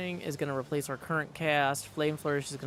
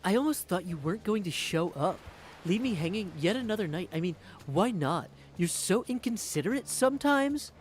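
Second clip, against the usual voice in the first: lightly put-on teasing voice, a bit higher; voice for Eris; 4 semitones higher, 3 dB louder than usual. Streamer recalls their voice for Eris from the first game, lightly hammed-up and petulant. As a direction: needle them like a sulky diva. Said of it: "I did some sort of Valier, like".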